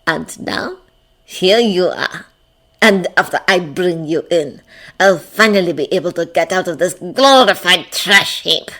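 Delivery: lightly voiced menacing handler; savoring every word of a villain's speech; gloating villain. A narrator's reading. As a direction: relish it like a smug captor.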